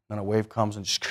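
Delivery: normal talking voice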